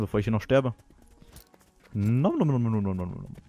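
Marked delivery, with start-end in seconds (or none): 1.9-3.4 playful eating noises; nom nom chomping. Pretend. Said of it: "Ich sollte mal was essen". (spielerisches Mampfen)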